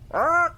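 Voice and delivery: high, short parrot screech; parrot squawk. Acted. Host repeating the parrot joke, squawking.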